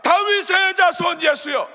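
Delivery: slow, high, full-force yell; drawn-out desperate shout; loud shouting. Acted. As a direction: holler over the people hushing you. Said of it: "막 크게 소리치는 거예요".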